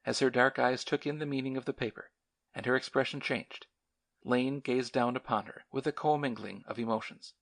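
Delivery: ordinary delivery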